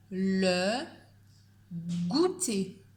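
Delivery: slow dictation, a pause before goûter. Shown as a demonstration. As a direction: say it clearly so pupils can spell it. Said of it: "Je répète une dernière fois".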